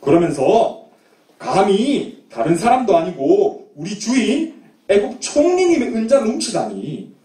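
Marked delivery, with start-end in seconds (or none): none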